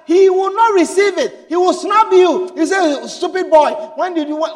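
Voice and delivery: put-on loud, high, scolding «Stupid boy»; a father snubbing his son's preaching; angry father.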